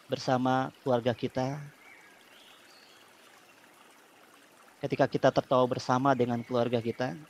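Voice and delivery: calm guided breathing voice; soothing spa whisper. Acted. Leads relaxation therapy.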